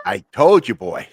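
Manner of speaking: imitating a scolding mom; sudden loud telling-off, acted out; mother's loud rebuke. Mother rebukes son, retold.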